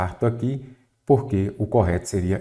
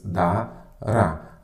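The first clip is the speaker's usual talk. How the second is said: "Dará" broken into two separate syllables; split into syllables. Shown as a demonstration. Teacher dividing a word for students, split into syllables. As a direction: say "Dará" syllable by syllable, pausing between. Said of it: "separação em sílabas".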